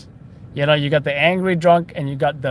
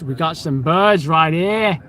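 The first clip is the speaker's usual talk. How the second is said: mock Australian nature-documentary voice; Australian accent. Spotting pigeons in New York City, hammed-up Australian accent. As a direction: do your best hammed-up Australian. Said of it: "In my best Australian voice".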